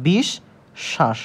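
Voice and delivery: "বিশ ... শ্বাস" in two parts, rising then falling; sh for dental s. Shown as a demonstration. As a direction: end বিশ on sh, pause, start শ্বাস with sh. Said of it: "তালব্য সর মতন উচ্চারণ হচ্ছে". ("বিশ ... শ্বাস" তালব্য শ দিয়ে ভেঙে উচ্চারণ)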